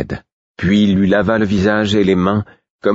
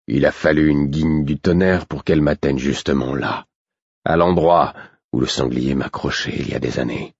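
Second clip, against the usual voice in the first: a hint of a lower, tired man's voice; wounded stable master; 3 semitones lower than usual. A narrator's reading. Burick recalls the arrow to Patience, lightly voiced weary man. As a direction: tell it like a worn-out soldier, lightly voiced.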